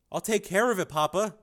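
a son eager to please. Acted. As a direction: son promising his father. Son replies.

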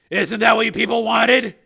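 loud, high, slow accusation; harsh. A narrator's reading. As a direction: fling it harshly in her face. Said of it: "he said harshly".

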